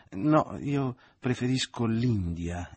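lamenting, drawn-out complaint; plaintive. A narrator's reading. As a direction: whine it like a spoiled girl. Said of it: "in tono lamentoso".

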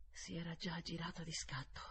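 ordinary delivery, nothing marked

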